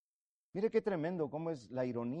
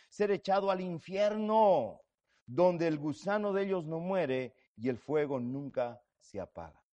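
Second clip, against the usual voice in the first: fervent sermon reading; scripture reading; 3 semitones higher than usual. Acted. Preacher reads scripture aloud.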